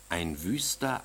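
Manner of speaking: usual speaking voice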